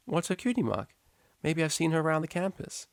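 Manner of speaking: a teacher gently nudging a student; patient high teacher; teacher's voice. A narrator's reading.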